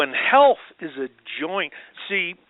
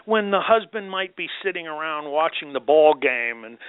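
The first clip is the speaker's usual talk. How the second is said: ordinary talk, nothing marked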